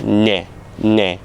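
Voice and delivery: Nie twisted, then ne straight; Polish versus Lithuanian N. Shown as a demonstration. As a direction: contrast Nie and ne as a demo. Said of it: "you just go straight".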